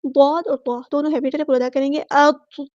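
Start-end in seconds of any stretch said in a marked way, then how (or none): 2.0-2.7 slow, drawn-out chanted recitation; tajweed chant; Quranic recitation. Shown as a demonstration. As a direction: recite it melodically, stretching each sound. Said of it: "recites Quran verse in tajweed".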